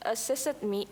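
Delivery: ordinary delivery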